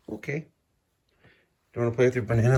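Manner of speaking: usual speaking voice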